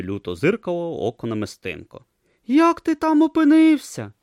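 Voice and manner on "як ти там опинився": Brutha asking. Acted. Brutha asks tortoise.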